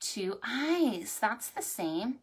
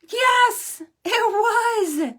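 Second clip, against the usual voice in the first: character voice; 14 semitones higher, 10 dB louder, much slower than usual. Acted. Horse answers.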